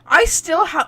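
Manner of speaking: usual speaking voice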